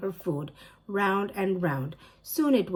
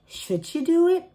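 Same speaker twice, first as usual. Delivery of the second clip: high, sly whispering temptation; high sneaky inner voice; tempted inner voice; 11 semitones higher, 4 dB louder than usual. A narrator's reading. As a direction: tempt yourself, a little mischievous. Ellie's tempted inner question.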